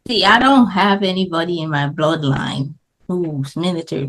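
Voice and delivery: ordinary talk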